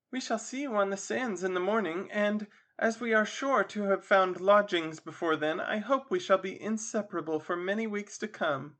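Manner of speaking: Missus Captain Waters. Acted. Missus Waters parting from Charlotta.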